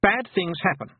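high, anxious warning; agitated boy. A narrator's reading. Boy warns the girl.

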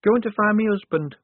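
put-on high-pitched, small-girl confession; timid confession. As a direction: blurt it out high, barely bolder. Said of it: "only a little bit louder".

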